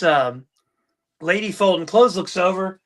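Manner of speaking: ordinary delivery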